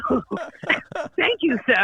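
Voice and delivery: high, stunned, overly polite thank-you; mock polite thanks. Comedian thanking the old man after his insult, stunned and polite. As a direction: thank him sweetly through disbelief. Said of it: "And I was like".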